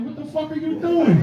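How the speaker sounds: high, squeaky baffled yell from the bully; mock squeaky bully yelling in disbelief; bully's disbelief. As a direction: pitch it way up in outraged confusion. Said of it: "He said".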